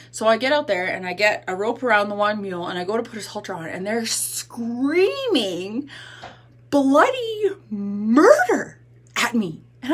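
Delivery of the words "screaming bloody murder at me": SCREAMING and MURDER stretched long and pitched up; slow, hammed-up telling with swooping SCREAMING and MURDER; dramatic emphasis. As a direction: draw out SCREAMING, punch MURDER high and loud.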